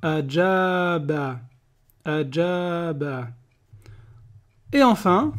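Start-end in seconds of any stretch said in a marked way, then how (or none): 0.0-3.3 ajaba said very slowly, twice; slow blending. Shown as a demonstration. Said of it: "Ça fait".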